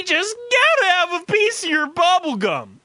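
high, loud mock ecstasy; ecstatic. Retelling the salesman grabbing gum, mock ecstatic exclaim. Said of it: "with ecstasy".

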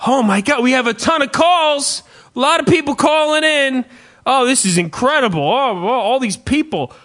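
high, loud gushing excitement; mock excited host. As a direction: gush like a thrilled radio host. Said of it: "shows will, will say".

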